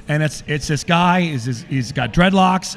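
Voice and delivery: ordinary delivery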